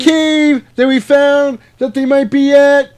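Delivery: high, loud, silly put-on voice; squeaky parody of a brave vampire hunter; high mock character voice. Voicing character in cave scene.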